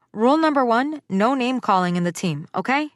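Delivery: high, commanding coach call; firm and loud. Acted. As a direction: lay down the law to the team. Coach laying down rules.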